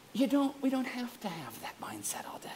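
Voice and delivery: real whispered aside. Real, not acted. Pastor quietly reassuring the class in a whisper. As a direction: drop to a whisper.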